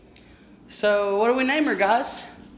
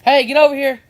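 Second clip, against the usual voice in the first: higher, louder call to the dog; 5 semitones higher, 8 dB louder than usual. Real, not acted. Owner calling his wandering dog back, loud and high.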